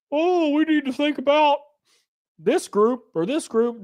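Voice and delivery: high, far-off mocking voice of an idealist; mock fussy voice. Mocking pre-war social concerns.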